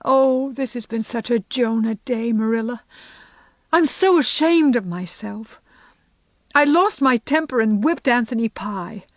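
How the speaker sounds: put-on girlish voice, ashamed and upset; lightly voiced distressed girl.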